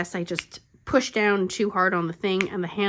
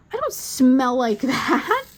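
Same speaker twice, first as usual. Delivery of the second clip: louder, higher, drawn-out mock whine of protest; offended teenager; 4 semitones higher, 6 dB louder, slower than usual. Mother mimicking her insulted teenager, offended. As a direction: imitate a teen protesting, laugh slipping in.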